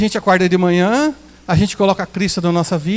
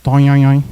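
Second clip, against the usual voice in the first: mocking nasal babble; 8 semitones lower, 5 dB louder than usual. Mocking someone's way of talking.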